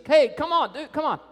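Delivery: high, loud mock holler. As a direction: yell after Jesus to hurry. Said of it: "about to holler at Jesus".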